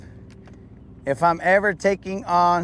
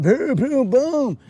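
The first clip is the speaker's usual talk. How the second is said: high punchy booms; booming sound effect, pitched up; imitated booms; 7 semitones higher, slower than usual. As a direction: pound out each boom like impacts. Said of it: "just be like".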